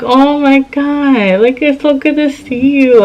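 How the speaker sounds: high voice, stretched-out opening, happily moved; retold emotional happiness. Woman retelling the reunion with her ex, happily moved. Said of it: "I almost started crying".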